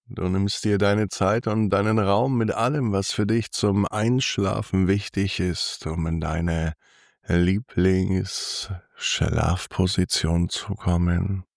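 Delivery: sultry narrator. Acted. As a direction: lull the listener toward sleep, unhurried. Guided body scan for sleep.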